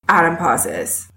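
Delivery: ordinary delivery, nothing marked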